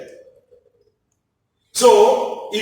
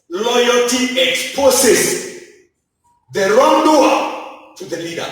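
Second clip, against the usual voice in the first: slow restatement. Acted. Preacher restating the principle.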